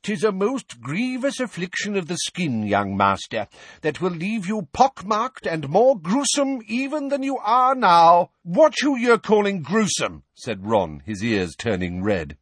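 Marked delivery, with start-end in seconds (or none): 0.0-8.3 put-on high, theatrical old-world healer; medieval wizard. A narrator's reading. Portrait healer pursuing an insulted boy, high medieval wizard. 8.4-10.2 loud, high indignant retort. A narrator's reading. Boy called gruesome by a portrait, loud indignant retort.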